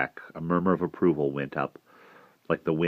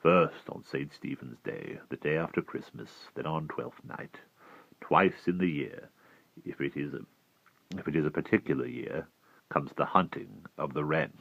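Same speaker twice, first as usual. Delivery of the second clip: hushed, much softer and lower storytelling voice; 3 semitones lower, 9 dB quieter than usual. A narrator's reading. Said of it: "Merriman said very softly".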